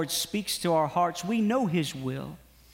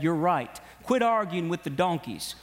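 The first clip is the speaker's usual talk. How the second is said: ordinary talk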